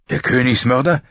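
hard, scornful question; contemptuous old knight. A narrator's reading. Barristan scorning the Kingslayer, hard and contemptuous. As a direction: spit out the name with contempt. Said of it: "die Stimme hart vor Verachtung".

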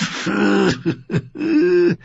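high, snorting laugh through the nose; a short scornful snort of a laugh; mocking snort of laughter. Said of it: "Ascher lachte schnaubend".

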